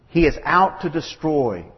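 ordinary delivery

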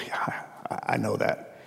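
whispered admission, barely voiced; sheepish whisper. Retelling his reply to the man owed money, whispered. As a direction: breathe it out like a guilty confession.

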